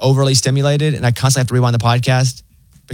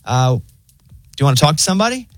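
high, laid-back robot assistant; breezy bot with bad news; chill AI voice; 7 semitones higher than usual. Pharmacy AI phone bot reports the delay, high and chill. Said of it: "And so they're like".

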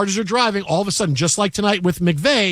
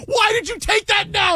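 high shouted scolding; angry fans yelling at the towel guy; mock angry yell. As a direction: blast him for jinxing the kick. Fans blaming guy for bad luck.

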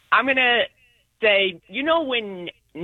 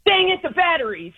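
bright, higher dang it; frustrated exclamation; 8 semitones higher than usual. Shown as a demonstration. [name] showing how she'd say it, exasperated. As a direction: blurt it out when the gift won't work. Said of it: "what do you say and how do you say it".

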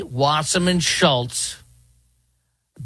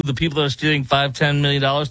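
usual speaking voice

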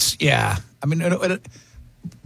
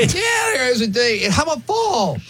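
voice cracking; 8 semitones higher than usual. Real, not acted. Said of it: "Oh, my voice just cracked".

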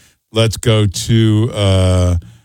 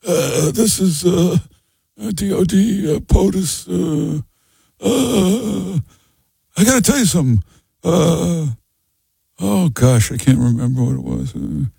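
higher-pitched, halting, drawn-out uhs; hammed-up impression of a forgetful rambler; mock doddering mumbler; 7 semitones higher, slower than usual. Host mocking the man on tape who can't remember, hammed-up doddering mimicry.

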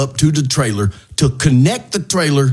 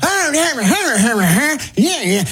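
high gibberish rant in a mock angry yell; mocking angry twin; 10 semitones higher than usual. Imitating the ranting neighbor to the radio host, high mock yell. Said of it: "he's like".

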